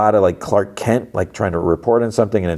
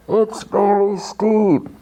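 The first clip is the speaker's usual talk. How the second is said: high, slow, stretched-out call; mock yell; 13 semitones higher, much slower than usual. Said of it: "Mark yells out".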